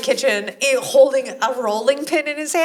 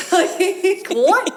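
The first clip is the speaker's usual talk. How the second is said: laughing; 8 semitones higher, 3 dB louder than usual. Real, not acted. Laughing at Jack's line.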